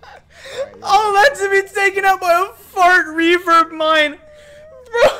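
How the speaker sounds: shrieking with laughter, high; screaming laughter. Real, not acted. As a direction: howl it while laughing. Friend blown up by mine.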